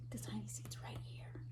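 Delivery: soft unvoiced whisper; breathy hush to little listeners. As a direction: whisper as if sharing a secret. Pointing out hidden seed to kids.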